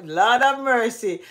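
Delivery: usual speaking voice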